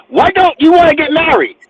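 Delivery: ordinary talk, nothing marked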